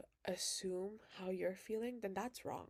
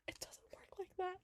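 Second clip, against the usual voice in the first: whispered aside; 12 semitones higher than usual. Real, not acted. Repeated line, loud then whispered.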